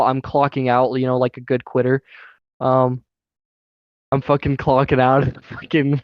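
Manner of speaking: normal talking voice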